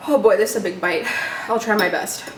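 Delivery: normal talking voice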